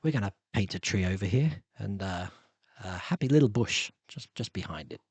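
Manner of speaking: low, soft, soothing painter voice; hushed and unhurried, dropping away at the end; Bob Ross. Acted. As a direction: murmur it like a gentle painting host. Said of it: "I could do Bob Ross".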